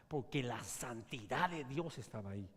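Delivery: ordinary talk, nothing marked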